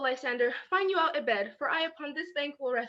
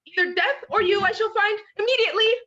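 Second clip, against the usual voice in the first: frantic calling; 4 semitones higher, 9 dB louder than usual. Acted. Hermia searching for vanished Lysander.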